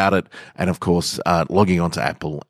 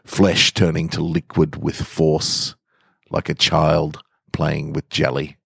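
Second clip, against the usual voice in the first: story narration; 3 semitones lower than usual. A narrator's reading. Podcast host reading a macabre story to listeners, measured narration. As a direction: draw listeners into a grim tale with even pacing. Reads a dark story aloud.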